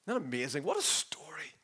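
soft, whispered wonder; hushed amazement. Real, not acted. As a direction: marvel at it under the breath. Marvels at the story just read.

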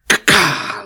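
bird caw imitation. Imitating the deputy's sound.

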